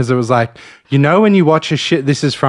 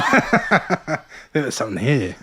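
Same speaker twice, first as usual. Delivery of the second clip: laughing; 2 semitones higher, 5 dB quieter, slower than usual. Real, not acted. Echoing while laughing.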